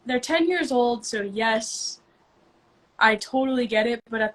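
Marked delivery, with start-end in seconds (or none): none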